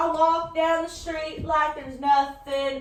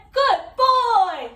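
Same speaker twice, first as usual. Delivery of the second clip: praising owner; 7 semitones higher, 5 dB louder than usual. Pretend.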